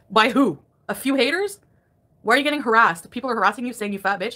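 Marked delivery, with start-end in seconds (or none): none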